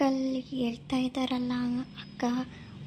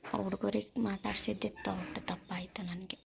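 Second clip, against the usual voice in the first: a muttered aside while walking off; regretful whisper to himself; whispered grumble; 3 semitones lower, 7 dB quieter than usual. Acted. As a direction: whisper it under your breath. Stranger mutters to himself leaving.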